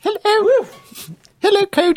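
squeaky high mock greeting; playful very high-pitched hello; silly high voice. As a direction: call hello in a comically high put-on voice. Joins in the silly voice joke.

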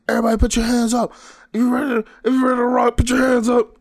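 high, loud and fast crowd call; mock hype yell. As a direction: imitate a rapper shouting to rile up the crowd.

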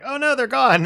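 high, loud cry of dismay; mock panic. Player recalling the lost spells in the app, mock panic. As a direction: wail it in mock alarm.

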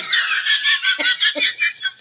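high breathy laugh; high laughter. Real, not acted. Laughing at own joke.

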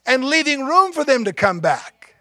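loud, high, ringing pulpit voice; full-force preaching. Real, not acted. Preacher urging the congregation to wait, loud and high. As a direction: preach it out to the whole room. Preaching about waiting for the lost.

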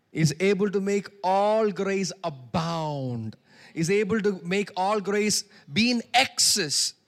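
slow, loud, ringing preacher proclamation; preaching at full force. Acted. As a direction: stretch the verse out loud like a pulpit cry.

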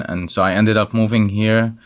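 ordinary delivery

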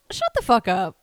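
loud mock outburst; retold disbelief. Acted retort to coworkers' suggestion.

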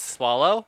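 rising, querying Swallow?; questioning tune. Shown as a demonstration. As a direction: Swallow lifted at the end like a question. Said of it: "I say it like a question".

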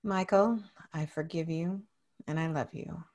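low, hushed affirmation; soft self-forgiveness. Real, not acted. Client repeats after coach, forgiving self, quiet and low. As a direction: say it softly, almost inward.

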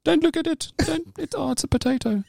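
very high put-on voice, faltering; mock dismay. Onlooker discovering the baby's a potato, high mock dismay. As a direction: act out comic horror at what's in the pram. Acts horror at potato in pram.

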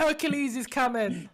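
high, loud Cockney woman nearly laughing; hammed-up Cockney prophetess; Cockney Cassandra. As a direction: shriek the warning in mock Cockney. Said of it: "they gave Cassandra a Cockney accent".

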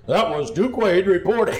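half-laughed announcer close; laughing announcer. Retold or imitated. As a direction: cover the blunder but crack up. Covering colleague's blunder, laughing.

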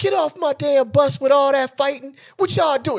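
mock Southern Atlanta bus driver hollering to stop the fight; Southern Atlanta accent. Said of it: "picture a deep Southern Atlanta accent".

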